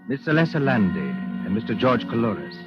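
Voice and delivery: ordinary talk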